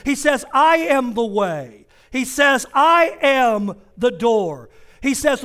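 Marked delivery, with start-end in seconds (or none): 0.5-4.7 slow, weighty, each word set down; slow proclamation. Acted. Preacher quoting Jesus to the church, slow and weighty. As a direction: proclaim it slowly.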